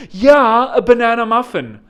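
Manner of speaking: loud, high mock-American echo of the lady; mocking agreement. Customer mimicking the drive-thru lady, loud sarcastic agreement. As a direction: parrot her back, exaggerated and loud. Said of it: "And I'm like".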